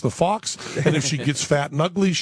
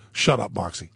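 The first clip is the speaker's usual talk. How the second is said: deadpan dad; 7 semitones lower than usual. Retold or imitated. Dad's dry reply to tattling.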